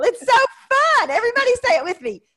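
high, loud and fast; excited. Real, not acted. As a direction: squeal with delight. Gleeful over the name.

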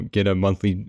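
usual speaking voice

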